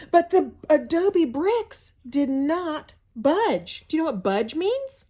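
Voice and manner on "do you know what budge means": plain reading voice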